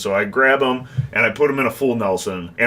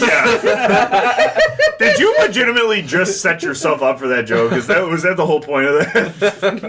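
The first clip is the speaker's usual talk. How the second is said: laughing; 7 semitones higher, 4 dB louder than usual. Real, not acted. Teasing a friend's setup joke.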